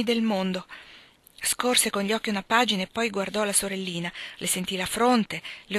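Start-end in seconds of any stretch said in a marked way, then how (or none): none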